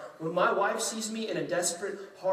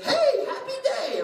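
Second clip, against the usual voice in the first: much higher, slow, sing-song cheerfulness with a laugh; chirpy morning wife; 8 dB louder, slower than usual. Retold or imitated. Husband imitating his wife's cheery morning greeting, high and sing-song. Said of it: "she's like".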